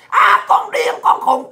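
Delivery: high mimicking taunt; hecklers' mock yelling. Ranter mimics critics calling them crazy, high mock yell. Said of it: "tụi bay la làng hoài".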